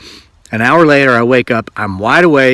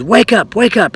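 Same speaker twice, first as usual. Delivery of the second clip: raised, high-pitched imitation of yelling; mock angry yell; 9 semitones higher than usual. Dream man rousing a sleeping woman, mock angry yell. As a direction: act out an irate wake-up call at modest volume. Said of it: "he just sounds really angry".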